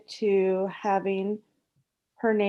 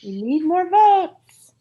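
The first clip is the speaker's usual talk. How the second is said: goofy cartoon-dog voice, higher and louder, rising at the end; hammed-up talking-dog impression; Scooby-Doo; 6 semitones higher, 7 dB louder than usual. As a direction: playfully howl it like a cartoon dog. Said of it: "will you do it in Scooby-Doo's voice?".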